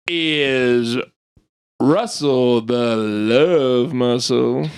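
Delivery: slow low host intro; announcer voice. Acted. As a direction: draw the nickname out.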